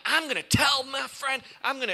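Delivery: high, loud hurt outburst; mock outburst. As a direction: blurt it out like someone just wronged. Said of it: "And you're like".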